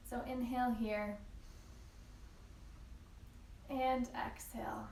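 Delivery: soft, unhurried yoga teacher voice with long pauses; calm, measured breathing cues; guided yoga meditation. Real, not acted. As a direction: guide them gently into stillness, leaving room to breathe. Leads a yin yoga practice.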